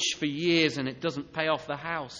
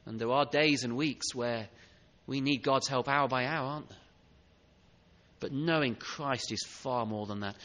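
soft pastoral appeal; 8 semitones lower, 5 dB quieter than usual. Acted. Pastor speaks softly, intimately.

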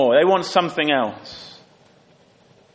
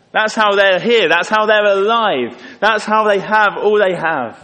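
louder, higher, driving each "That's how" home; emphatic preaching; 5 semitones higher, 6 dB louder than usual. Real, not acted. Preacher insisting to the congregation that God gave everything, loud and emphatic. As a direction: preach it with rising force. Preacher hammering God's provision.

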